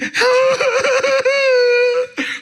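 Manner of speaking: very high, loud mock wail; long exaggerated sob; imitated crying. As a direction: break into hammed-up bawling. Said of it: "and she's crying".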